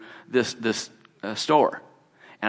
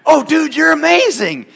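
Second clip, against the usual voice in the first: much higher and louder praise; excited praise; 9 dB louder than usual. Retold or imitated. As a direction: cheer him on with amazement. Praising the healer guy.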